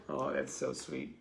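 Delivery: ordinary talk, nothing marked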